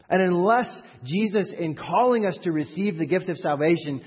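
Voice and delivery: impassioned, high-energy sermon delivery; full-force preaching. Real, not acted. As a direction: preach it hard to the whole room.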